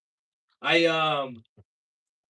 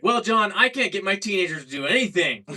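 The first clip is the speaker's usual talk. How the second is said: louder, higher mock anchor reply; hammed-up news anchor voice; 5 semitones higher, 5 dB louder than usual. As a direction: play the cheerful TV host brushing it off. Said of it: "They're like".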